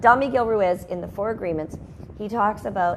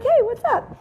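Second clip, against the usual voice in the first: high, bright mock-friendly hello; cheery greeting; 4 dB louder than usual. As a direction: greet the caller sweetly, pitched way up. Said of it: "I'll be like".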